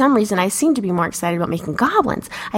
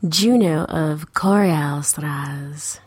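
slow breathy whisper of the name; whispered sign-off; 6 semitones lower, much slower than usual. Acted. Host signing off her name in a slow whisper. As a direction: whisper it slowly.

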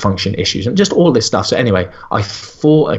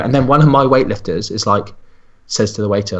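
ordinary delivery

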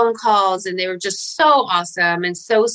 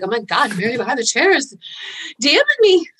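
retold alarm; 4 semitones higher than usual. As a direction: replay a startled, scared reaction. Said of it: "I was kind of scared".

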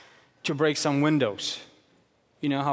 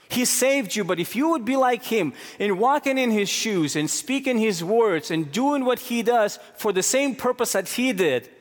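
preaching at full force; 6 semitones higher than usual. Acted. Pastor preaching to congregation about God's love, fervent and high. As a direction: preach it hard with rising passion.

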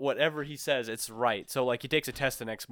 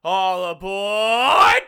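high, loud, drawn-out train call; loud conductor call; 8 semitones higher, 12 dB louder, much slower than usual. Shown as a demonstration. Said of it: "give me your best "All aboard".

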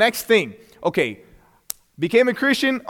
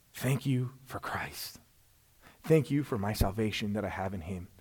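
whispered prayer; 12 semitones lower, 14 dB quieter than usual. Real, not acted. Preacher whispering gratitude to God, hushed prayer. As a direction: breathe a thank-you to God. Preacher prays thanks aloud.

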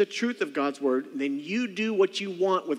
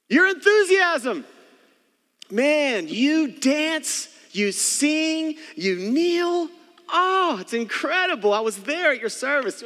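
much higher and louder, drawn-out fake awe; sarcastic gushing; 11 semitones higher, 6 dB louder, slower than usual. Paul praising the church's worship, high sarcastic awe. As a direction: ham up the wonder, over the top. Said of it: "he goes on".

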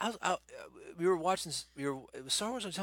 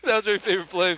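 asked through laughter, louder; laughing question; 6 semitones higher, 11 dB louder than usual. Real, not acted. Teasing his friend about the [name] voice, laughing. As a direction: laugh while asking.

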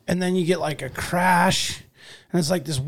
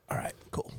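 whisper; 18 dB quieter than usual. Retold or imitated. In front of you, hiding excitement.

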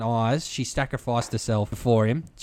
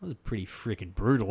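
muttered aside; 3 semitones lower, 10 dB quieter than usual. Retold or imitated. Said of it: "I was more like".

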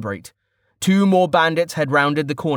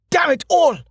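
high, explosive exclamation; desperate shouted curse; 4 dB louder than usual. A narrator's reading. Archer facing a raised sword, shouting in frustration. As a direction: yell the curse as danger closes in.

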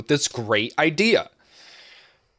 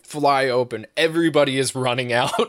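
ordinary delivery

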